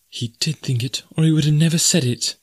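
high, troubled reflection; hurt young woman. A narrator's reading. Jane reasons to herself, hurt.

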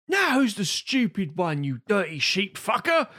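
a put-on movie villain's taunt; Juggernaut movie quote. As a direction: ham up the Juggernaut's big line. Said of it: "And then he says".

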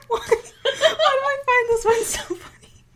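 high, loud laughing question; helpless laughter through words. Real, not acted. Cracking up mid-reading.

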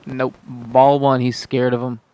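excited play-by-play call; frantic mock sports commentary. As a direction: call the game like a TV announcer. Live play-by-play of video game.

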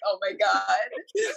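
slow laughing gasp; breaking into helpless laughter. Retold or imitated. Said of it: "and I was like".

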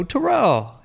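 high-pitched, drawn-out hail; retold excited call. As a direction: call the name insistently. Calling the name a third time.

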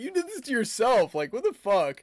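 high, loud, fed-up retort; exasperated outburst. Retold or imitated. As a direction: throw it back at her, outraged. Exasperated retort to her, retold.

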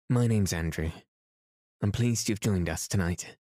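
usual speaking voice

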